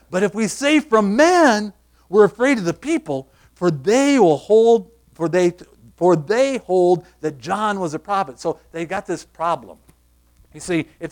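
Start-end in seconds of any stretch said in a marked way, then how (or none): 0.1-8.4 louder, slow, anxious plea; mock fear of the crowd; worried elders. Elders fearing the people, loud mock anxiety. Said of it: "they say very plainly".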